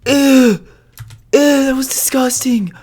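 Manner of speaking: high disgusted whisper; grossed out. Real, not acted. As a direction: recoil in disgust. Grossed out by sneeze.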